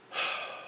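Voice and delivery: long, soft exhale; heavy sigh. Real, not acted. Winded storyteller pausing mid-tale, soft heavy sigh. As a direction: let out a tired breath. Catching breath while recounting.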